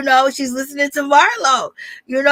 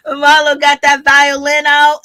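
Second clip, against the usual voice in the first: louder, slow, drawn-out mockery; gleeful teasing; 3 semitones higher, 7 dB louder than usual. Real, not acted. Vlogger mocks Marlo's sob story, gleeful teasing. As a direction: tease it out loud and slow.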